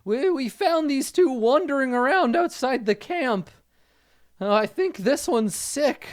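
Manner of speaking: high, anxious-to-report character voice; high-pitched skeleton underling; Fezzik. Acted. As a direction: report upward in a high, stammering voice. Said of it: "and Fezzik says".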